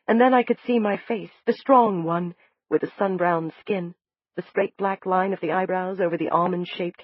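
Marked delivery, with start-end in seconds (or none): none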